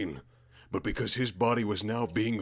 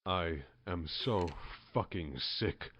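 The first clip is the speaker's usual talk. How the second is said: seething; 6 semitones lower, 13 dB quieter than usual. Acted.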